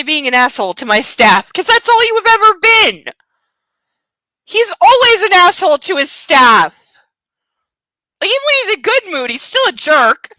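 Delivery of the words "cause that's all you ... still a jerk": high, loud outraged rant; exasperated yell. Real, not acted. Ranting back at the book's narrator, a high, loud yell. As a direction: shout your frustration at him.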